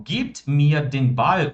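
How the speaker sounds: very slow, every German word laid out clearly; slow model sentence. Shown as a demonstration. Teacher modelling German for students, very slow and clear. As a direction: spell the sentence out slowly for the class. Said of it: "quando eu falo".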